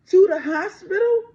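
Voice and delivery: loud voice breaking into a sob; anger breaking into tears; real crying. Real, not acted. Voice cracks into crying.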